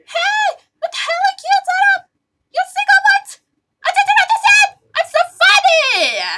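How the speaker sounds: frantic yelling. Acted. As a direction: yell it in a frantic panic.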